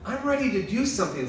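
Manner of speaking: Jesus gently correcting Mary; Jesus reminding. Retold or imitated. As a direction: remind her patiently from on high. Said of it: "he just reminds her".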